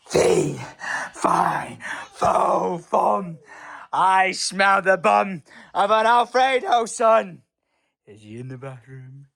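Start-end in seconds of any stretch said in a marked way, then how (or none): 0.1-7.4 playful giant voice. Pretend. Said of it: "playing giant in hide-and-seek".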